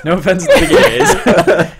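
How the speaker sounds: slow, laughing disclaimer; giggling through an apology. Real, not acted.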